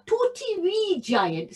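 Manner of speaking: high, small-sounding phrase; tiny-sized description. A narrator's reading. Storyteller introduces a tiny giant, high-voiced. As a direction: lift the pitch for littleness.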